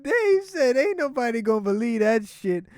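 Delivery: high-pitched, tickled retelling; rolling with laughter. Real, not acted. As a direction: repeat the joke while cracking up. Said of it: "That shit had me rolling".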